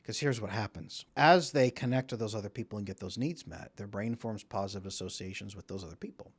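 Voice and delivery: ordinary talk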